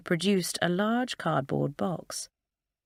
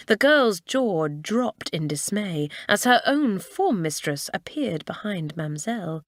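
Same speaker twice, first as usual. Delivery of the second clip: plain reading voice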